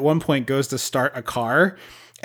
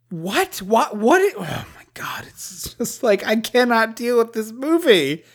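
mock exasperation; 7 semitones higher, slower than usual. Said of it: "and I'm like".

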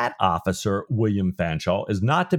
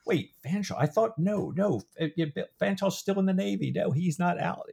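high, softer voice acting out puzzlement; confused self-quote; 10 semitones higher, 4 dB quieter than usual. Confused reader sorting out which Fanshawe is which, high and puzzled. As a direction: work it out aloud, pitched up high.

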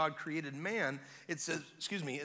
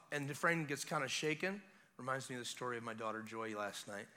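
usual speaking voice

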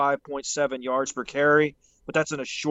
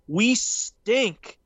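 STINK hit high and drawn out; emphatic stress on stink; 6 semitones higher than usual. Real, not acted. Podcaster on the Colts' short yardage failings, emphatic stress on stink. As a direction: lean hard on the word "stink". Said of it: "I can't emphasize that word stink enough".

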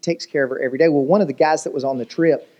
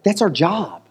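ordinary delivery

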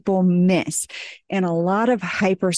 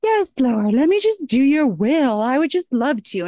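mock obedient daughter; 6 semitones higher than usual. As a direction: play the perfectly willing daughter, hammed-up. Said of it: "I'm never the daughter of God that's like".

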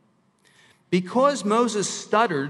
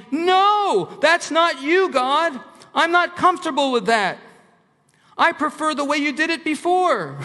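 high, loud objection; shouted protest; 10 semitones higher, 5 dB louder than usual. Acted. Someone objecting to God's new way, high shouted protest. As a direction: shout back in stubborn refusal.

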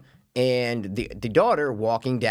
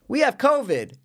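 put-on kid voice; 12 semitones higher than usual. Kids say they had covid.

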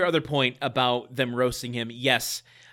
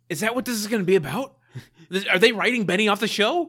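excited hope; 7 semitones higher, 5 dB louder, faster than usual. Retold or imitated. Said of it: "my heart sang".

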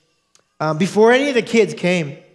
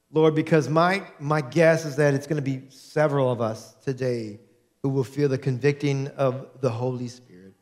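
prayer; 4 semitones lower than usual. Real, not acted. Pastor praying before sermon.

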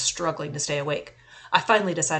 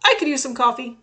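higher and louder, chiming in; speaking up; 6 semitones higher, 8 dB louder than usual. A narrator's reading. Narrator answers the cops' coffee invitation, speaking up. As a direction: pipe up so the group hears you. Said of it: "I spoke up".

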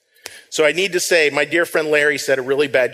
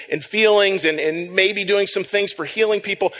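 ordinary delivery

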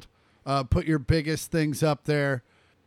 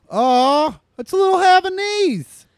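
much higher, louder delighted squeal; gushing over puppy; 13 semitones higher, 8 dB louder than usual. Real, not acted. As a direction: melt at the cute dog photo. Seeing the puppy picture.

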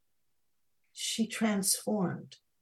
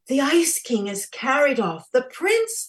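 high, loud dismay; alarmed; 8 semitones higher, 10 dB louder than usual. A narrator's reading. Snow Princess realising the prince is taken, alarmed. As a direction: exclaim it in horror.

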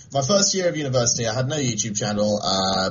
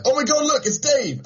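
mock excited shout. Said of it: "so they're like".

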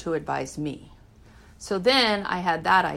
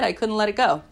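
ordinary talk, nothing marked

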